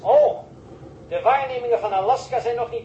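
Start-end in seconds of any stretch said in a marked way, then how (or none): 0.0-0.4 a sudden loud oh; sharp burst of surprise; startled oh. Acted. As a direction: caught off guard and blurting it out. Startled reply over the radio.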